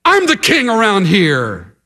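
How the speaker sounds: high-pitched, dismissive boast; self-important King Saul. Acted.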